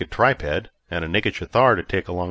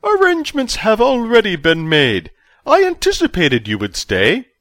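high, loud, overjoyed official; giddy chancellor; 14 semitones higher, 7 dB louder than usual. A narrator's reading. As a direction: burst out with delight like a giddy schoolboy. Verbidi speaking.

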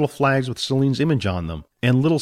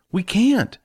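whispered; 10 semitones higher than usual. Acted. Jack refuses Billy.

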